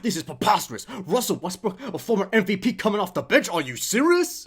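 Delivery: loud, high, outraged sports-pundit rant; hammed-up TV debate outrage, voice climbing; Stephen A. Smith impression. Imitating a pundit raging about a bench decision, loud and high. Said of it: "And he'll be like".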